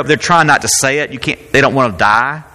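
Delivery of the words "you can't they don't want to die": usual speaking voice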